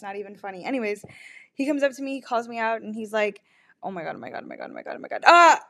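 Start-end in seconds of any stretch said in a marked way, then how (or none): 3.8-5.2 breathless panicked whispers; frantic hushed oh my Gods; panicked gasping. Retold or imitated. Panicking that he texted back. 5.3-5.6 a sharp loud high shriek; loud startled shriek; sudden shriek. Retold or imitated. Shrieks seeing a message.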